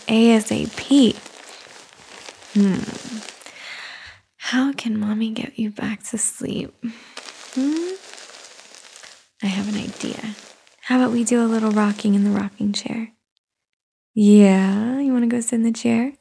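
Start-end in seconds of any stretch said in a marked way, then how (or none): 4.4-13.1 whispering. Acted. Whispers to settle the baby.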